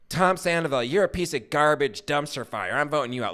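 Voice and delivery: sling the insult like reality TV; nasty American contestant. Retold or imitated. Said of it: "In America, it's like".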